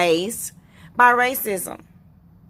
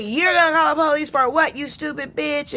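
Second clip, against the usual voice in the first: higher, fast mock customer sneering back; imitating a nasty customer; 6 semitones higher, faster than usual. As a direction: mimic the customer snapping at her in a fast, high voice. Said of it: "And they're like".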